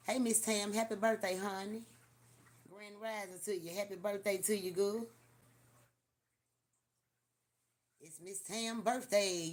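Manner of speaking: high, bright birthday call-out; birthday greeting. Real, not acted. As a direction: cheer her birthday loudly.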